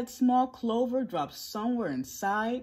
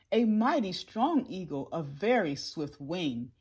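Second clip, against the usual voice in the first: ordinary delivery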